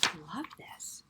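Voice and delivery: soft delighted whisper; hushed whisper of delight. Acted. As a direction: breathe it like a secret.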